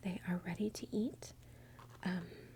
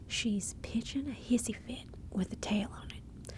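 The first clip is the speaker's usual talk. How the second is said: lively, lilting Southern saying; Southern accent; 3 semitones higher than usual. Shown as a demonstration. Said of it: "in a Southern accent".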